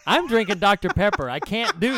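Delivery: giggling through the words; barely holding in laughter; laughing. Real, not acted. Cracking up mid-review.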